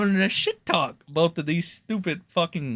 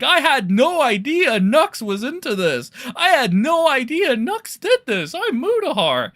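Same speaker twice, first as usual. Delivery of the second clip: high, whiny mock voice; mock a creator playing dumb; mocking Mutahar; 8 semitones higher than usual. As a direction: sneer it in a high fake-innocent voice. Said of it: "When Mutahar was like".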